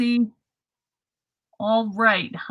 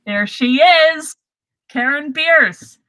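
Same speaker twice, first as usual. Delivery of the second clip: excited; 5 semitones higher, 8 dB louder, slower than usual. Real, not acted. Announcing special guest loudly.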